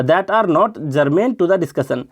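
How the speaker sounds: each word stretched out very slowly; very slow reading. Shown as a demonstration. Teacher reads the example sentence very slowly for students. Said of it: "example के लिए बोल रहा हूँ".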